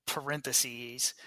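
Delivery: ordinary delivery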